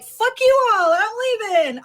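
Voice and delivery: high, gleeful mock send-off; mock defiant outburst. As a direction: play an exaggerated triumphant exit. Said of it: "I didn't say it like that".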